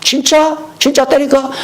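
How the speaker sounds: high incredulous question; teacher checking if students mean it; mock disbelief. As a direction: pretend surprise at the request.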